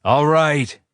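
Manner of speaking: calm the crazy cop loudly; shout. A narrator's reading. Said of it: "I shout".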